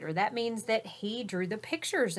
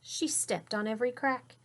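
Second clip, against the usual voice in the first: ordinary delivery, nothing marked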